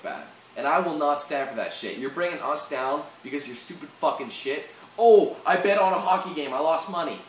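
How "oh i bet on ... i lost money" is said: louder sarcastic imitation; mocking whine. Vlogger mocking a rioter who lost a bet, sarcastic. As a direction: parody the sore loser's excuse.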